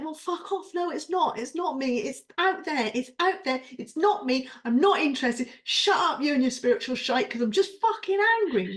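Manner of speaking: woman reliving her furious reply to a friend; angry outburst. Retold or imitated. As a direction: snap back at the spiritual advice, loud and high. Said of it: "I'm like".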